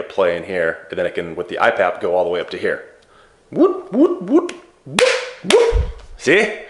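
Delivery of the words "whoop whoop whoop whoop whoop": high, loud rising whoops like a siren; playful whoops. Pretend. Showing viewers the pressure climbing, with high playful whoops.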